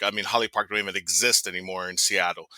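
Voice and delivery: ordinary talk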